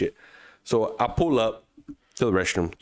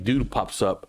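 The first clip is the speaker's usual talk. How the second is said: usual speaking voice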